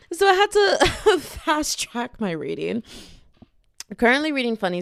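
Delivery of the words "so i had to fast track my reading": laughing. Real, not acted. Laugh on line.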